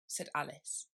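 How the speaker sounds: plain reading voice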